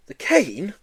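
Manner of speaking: high, horrified question; appalled disbelief. A narrator's reading. Joe horrified.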